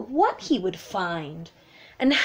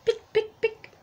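pecking sound; 10 semitones higher than usual. A narrator's reading. Egg pecking sound in story.

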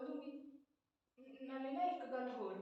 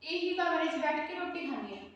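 defiant retort; 3 semitones higher, 6 dB louder than usual. Acted. Landlady defends eating in room.

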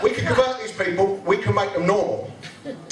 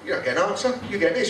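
normal talking voice

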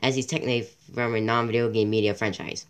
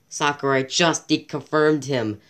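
normal talking voice

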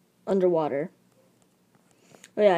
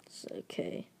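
soft unvoiced whisper; 13 dB quieter, slower than usual. Real, not acted. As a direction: whisper it so nobody wakes. Family asleep, speaking hushed.

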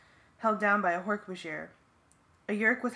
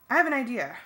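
pitched up and louder; eager suggestion; 4 semitones higher, 5 dB louder, faster than usual. A narrator's reading. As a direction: blurt it out, a quick bright idea. Marco acts excited line.

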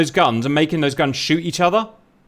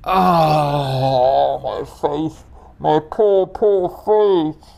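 mock lament; much slower than usual. Real, not acted. Pilot lost, mock lament.

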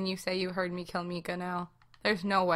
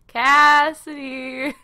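drawn-out, loud, higher sing-out of the name; taunting call; 5 semitones higher, 9 dB louder, much slower than usual. Pretend. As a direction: call out slowly to someone hiding. Killer calling out to hiding player.

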